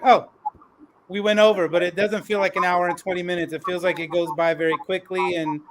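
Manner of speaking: ordinary talk, nothing marked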